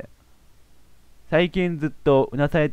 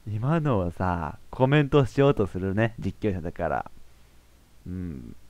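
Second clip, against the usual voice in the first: much softer, slow dazed murmur; stunned murmur; 6 semitones lower, 8 dB quieter, slower than usual. Real, not acted. The commentator at a loss after the scene, softly. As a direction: mutter half to yourself in disbelief.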